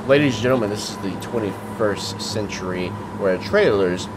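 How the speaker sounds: normal talking voice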